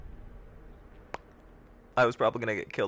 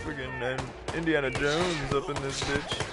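put-on voice; 4 semitones higher than usual. Slow put-on voice.

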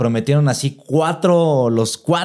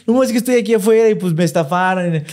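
laughing mock fan; 5 semitones higher than usual. Imitating scammed fan, laughing.

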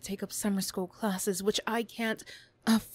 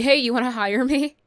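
higher, louder sarcastic mock plea; mock job ask; 6 semitones higher, 11 dB louder than usual. Bully mocking herself asking a daycare for work, loud and sarcastic. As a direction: ham up a silly job request. Mock quote inside rant.

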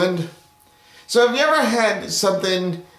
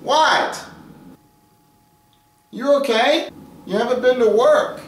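higher character voice; 9 semitones higher, 3 dB louder than usual. Acted. Coworker checking on the artist.

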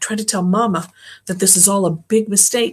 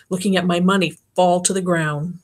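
ordinary delivery, nothing marked

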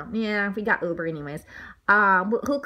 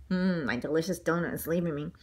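sad mumble; 7 semitones lower, 7 dB quieter than usual. Acted. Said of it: "Mister Bing replied sadly".

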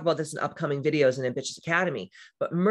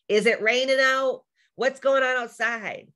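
imitating husband; 7 semitones higher than usual. Mimicking Matt asking about the weather from bed, lightly mocking. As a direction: lightly parody his clueless question. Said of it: "He's like".

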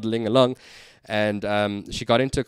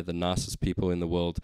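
ordinary talk, nothing marked

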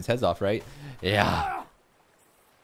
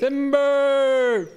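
long, high shout; calling timber; 8 dB louder than usual. Pretend. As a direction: yell it like a lumberjack. Tree falling, calling out.

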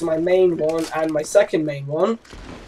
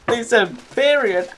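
giggling; 5 semitones higher, slower than usual. Retold or imitated. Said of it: "everyone would be like".

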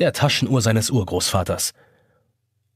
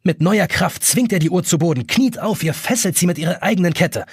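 usual speaking voice

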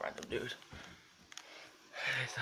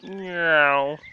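loud meow; 11 dB louder than usual. Real, not acted. Meowing at the cat.

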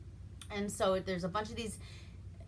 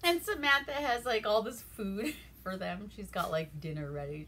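ordinary talk, nothing marked

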